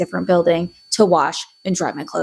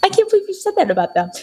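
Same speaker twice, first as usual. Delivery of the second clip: mock shock; 12 semitones higher, faster than usual. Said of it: "I was like".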